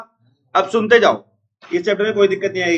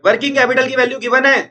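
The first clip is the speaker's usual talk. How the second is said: high, raised teaching voice; speaking forcefully loud; 6 semitones higher, 3 dB louder than usual. Real, not acted. Teacher calling out the given value to students, loudly. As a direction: push it out high over the class. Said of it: "मैं जोर से बोल रहा हूँ ना".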